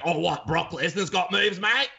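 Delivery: high, slowed broad Australian pub voice; broad Australian accent. Retold or imitated. Pub fan arguing about wrestling, broad Australian accent. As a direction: play a rowdy Australian bloke arguing. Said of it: "putting on an Australian accent".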